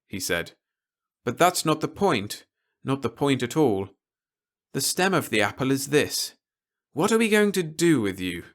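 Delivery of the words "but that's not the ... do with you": a lightly raised, measured officer's voice; stern officer. A narrator's reading. Officer warning Peter against anything rash, lightly voiced and grave.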